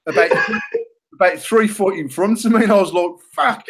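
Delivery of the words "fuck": dismayed fuck. Retold or imitated. Retold reaction to the bad shot.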